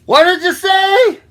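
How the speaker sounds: high, loud shouted question; loud, high-pitched bird character; hard-of-hearing woodpecker. Acted. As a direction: shout back as if you can't hear. Woodpecker cannot hear the frog.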